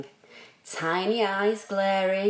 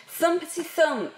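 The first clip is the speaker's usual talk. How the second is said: higher and fast, bouncing like big feet; bouncy thumpity thump; 7 semitones higher, faster than usual. A narrator's reading. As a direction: bounce through it quickly. Reading stomping feet sound word.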